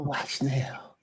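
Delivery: unvoiced whispered name. Real, not acted.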